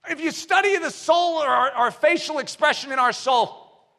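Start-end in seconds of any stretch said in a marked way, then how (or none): none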